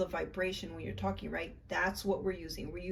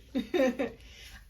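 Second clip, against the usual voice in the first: laugh; 6 semitones higher, 4 dB louder than usual. Real, not acted. Happy after meditation.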